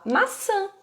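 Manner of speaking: put-on child naming the fruit for the parent; child's voice. Shown as a demonstration. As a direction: say it small like a shy toddler. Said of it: "Ela fala".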